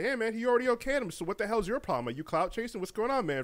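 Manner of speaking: mildly heated imitation of a challenge; confrontational. Fans confronting a streamer for clout chasing, mock confrontational. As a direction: raise the pitch and press him with questions.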